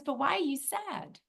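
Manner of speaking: lightly voiced little soul; puzzled little soul. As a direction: ask as a concerned little soul. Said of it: "she notices that it's sad".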